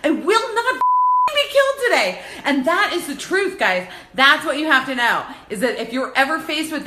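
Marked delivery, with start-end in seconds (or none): 0.0-2.2 retold scream. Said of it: "I started screaming".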